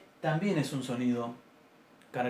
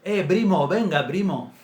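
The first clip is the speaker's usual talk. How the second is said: loud mock Arabic accent, "primo" with a b sound instead of p; Arabic speaker's accent; 7 dB louder than usual. Teacher imitating Arabs calling out to a cousin in a mock Arabic accent. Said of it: "los árabes dicen".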